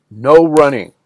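a sharp call of "No running". Retold or imitated. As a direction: call across the house to stop them. Sandy calls out to kids.

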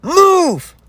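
imitating her own furious shout behind the wheel; road-rage shout. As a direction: scream it at the car hogging the passing lane. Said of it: "I am angry as fuck".

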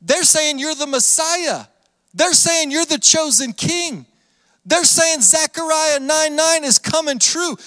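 high-pitched, acted indignant complaint; alarmed protest. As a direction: play a scandalized objector reporting an outrage. Pharisees acted protesting to Jesus.

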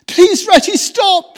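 high, loud desperate pleading; anguished plea. A narrator's reading. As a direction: beg him to stop, heart aching. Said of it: "pleaded poor Mole in anguish of heart".

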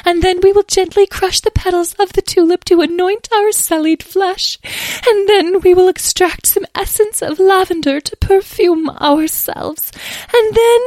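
very high, loud fairy voice; dramatic fairy. Acted. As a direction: play a fussy fairy up high.

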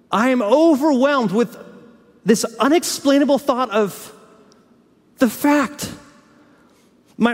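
slow, deliberate mock outrage that rises in pitch and volume, then sinks; the preacher voices Paul, building to steaming mad; Paul's astonished anger. Preacher acting Paul scolding the Galatians, slowly building outrage. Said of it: "And the tone changes".

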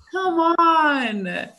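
high, loud, drawn-out protest; loud imitated groan of disbelief; exasperated plea. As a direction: cry out in amused exasperation. Exasperated after student gives in.

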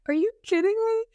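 sudden high squeak of disbelief; shocked disbelief. Retold or imitated. Telling how she reacted to her friend's blunt warning, with high shocked disbelief. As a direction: blurt it out, stunned and indignant.

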